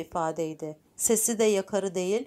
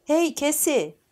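high, raised call from afar; 8 semitones higher, 4 dB louder, slower than usual. Acted. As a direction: hail him with a raised voice. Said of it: "diye bağırdı". (uzaktakine bağırarak seslenme)

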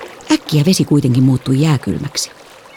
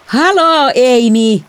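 high, drawn-out call; shouted hail from a distance; 10 semitones higher, much slower than usual. A narrator's reading. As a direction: holler to get someone's attention. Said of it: "minä huusin".

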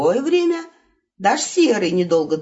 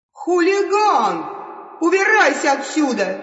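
angry goddess; 9 semitones higher than usual. Acted. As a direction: shoo the hooligan away. Goddess shouts at wolf. (высокий гневный окрик богини)

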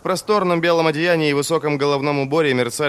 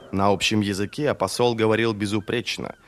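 ordinary delivery

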